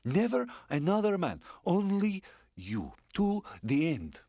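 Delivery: high, eager woman's voice; a woman swearing devotion, high and eager; excited. Acted. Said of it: "Excitedly, she rose to her feet".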